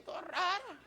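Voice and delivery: high, odd frog-like voice, mocking off-key singing; frog in a puddle. Preacher mocks worship sung during trials, frog-like imitation. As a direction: mimic a singer croaking like a pond frog. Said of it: "como que fuera una rana en charco".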